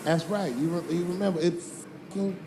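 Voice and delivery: ordinary talk, nothing marked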